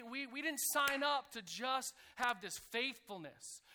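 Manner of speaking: usual speaking voice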